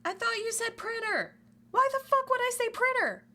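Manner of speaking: very high, loud squeal of outrage; squeaky angry shout. Retold or imitated. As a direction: screech in outrage. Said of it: "And he's like".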